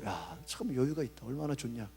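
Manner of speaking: quiet envious aside; wistful inner voice. Retold or imitated. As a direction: muse enviously under your breath. Pastor voices his own envious thought.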